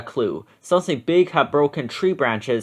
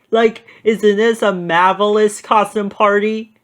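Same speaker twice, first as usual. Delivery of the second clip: grinning Shaggy; 6 semitones higher than usual. A narrator's reading. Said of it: "Shaggy grinned".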